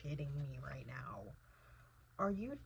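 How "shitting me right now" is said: whispered disbelief. Real, not acted. Disbelief at plot twist.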